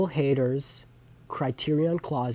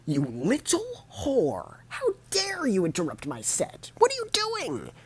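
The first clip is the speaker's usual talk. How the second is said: much higher, strained mock rage at the crowd; comic ranting at heckler; 14 semitones higher than usual. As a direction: scold the heckler like a bitter comic. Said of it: "whole thing, he's like".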